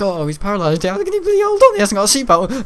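sudden jump up high and loud on bloody hold on; a panicked realisation, half-laughing at himself; alarmed outburst. Retold or imitated. Dad realising his paralysed son has no seatbelt, rising alarm. Dad's alarmed thought on the ride.

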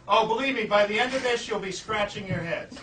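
ordinary talk, nothing marked

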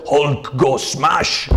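slow, drawn-out mock monster roar; Hulk. Reviewer showing what the film offers, slow mock Hulk cry. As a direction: imitate a brutish hero's battle cry. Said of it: "like that and stuff like that".

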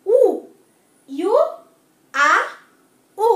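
ordinary talk, nothing marked

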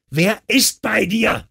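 slow, very high strained yell; bellowed demand. A narrator's reading. Husband demands who is with his wife, roaring. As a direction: roar each word down the phone, drawn out. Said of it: "brüllte Mark".

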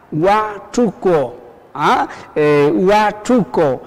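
"huachuco" very slow, broken as "hua-chu-co"; syllable by syllable. Shown as a demonstration. Teacher presenting a new word, slow syllable by syllable. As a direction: stretch "huachuco" out, pausing between syllables. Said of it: "les presento la palabra".

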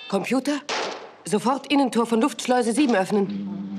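urgent loud command. Acted. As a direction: call out the order urgently. Urgent order to computer.

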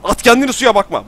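high, loud command; urgent shout. Real, not acted. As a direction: yell the order. Karakterine bağırıyor. (telaşlı bağırış)

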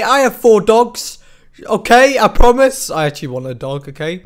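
usual speaking voice

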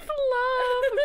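very high, loud mimicking cry; mocking wail. Player mocks friend's berry gift, mocking wail. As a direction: throw it back as an exaggerated wail.

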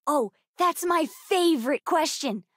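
ordinary talk

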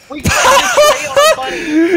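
loud, very high laughing shriek; laughing disbelief. Real, not acted. Player realising they felled a tree on a bunny, laughing disbelief. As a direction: shriek it through helpless laughter.

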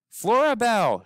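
high, loud call of a name; shouted name. Acted. Boy shouting after the girl in the car. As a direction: yell her name to get her attention. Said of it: "shouted William Spiver".

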